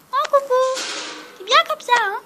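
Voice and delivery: very high cooing hello to a dog. Real, not acted. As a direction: squeak a sweet hello to the pet.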